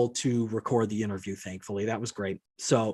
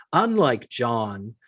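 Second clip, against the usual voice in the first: drawn-out, deliberate email reading; slow mocking readback; much slower than usual. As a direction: read it out very slowly. Repeats email opening after aside.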